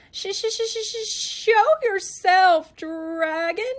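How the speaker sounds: very high, stuttering challenge; stammering scared knight. Acted. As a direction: stammer bravely though terrified.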